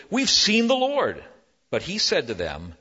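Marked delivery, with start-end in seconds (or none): 0.1-1.2 high, loud, eager report; excited disciples. Acted. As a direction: burst out with the good news.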